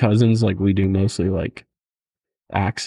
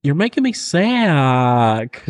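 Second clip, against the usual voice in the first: high, slow Southern whine; hammed-up Southern complaint; put-on Southern accent; 5 semitones higher, much slower than usual. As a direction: stretch the last word into a twangy Southern drawl. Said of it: "the one you say to Ivan".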